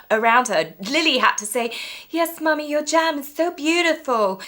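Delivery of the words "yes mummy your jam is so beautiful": put-on timid child. Imitating timid child Lily.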